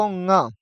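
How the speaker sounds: "ङ" as a held ng opening into a; nasal nga. Shown as a demonstration. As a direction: hum the ng, then open "ङ" into nga. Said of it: "इनका सही उच्चारण हमको करना है".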